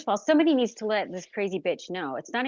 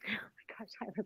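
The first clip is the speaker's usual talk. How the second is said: breathy giggle into a whispered oh my gosh; laughing whisper; 16 dB quieter than usual. Real, not acted. Streamer flustered by a viewer, giggling in a whisper. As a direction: laugh it off under your breath. Flustered while reading chat.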